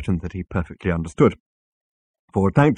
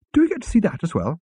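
nervously; 12 semitones higher than usual. A narrator's reading. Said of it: "asked Arthur nervously".

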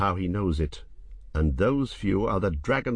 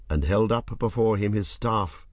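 ordinary delivery, nothing marked

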